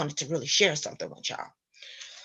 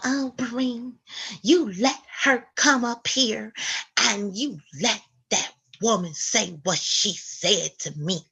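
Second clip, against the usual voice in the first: Ethel whining. Retold or imitated. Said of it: "she's whining and she's crying".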